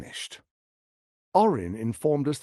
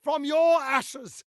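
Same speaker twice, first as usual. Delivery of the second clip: slow, higher-pitched triumphant declaration; grand proclamation; 9 semitones higher than usual. Acted. Tyrant vows Baldur's Gate will rise again, slow and triumphant. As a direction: proclaim a city's rebirth like a zealot.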